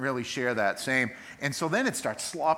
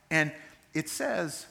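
usual speaking voice

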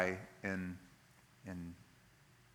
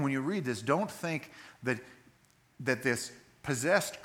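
ordinary talk, nothing marked

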